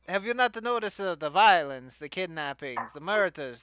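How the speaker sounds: hammed-up fantasy Italian accent; bad stage Italian for a fantasy character; fake Italian accent. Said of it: "bad, fake fantasy Italian accent".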